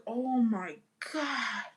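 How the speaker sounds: slow, drawn-out awe; stunned. Retold or imitated. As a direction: breathe it out slowly in shock. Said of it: "I was like".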